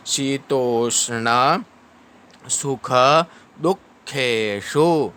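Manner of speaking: slow measured Sanskrit verse; slow recitation. Shown as a demonstration. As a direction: recite the phrase drawn out.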